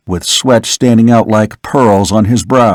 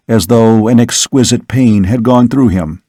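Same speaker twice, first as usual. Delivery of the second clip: plain reading voice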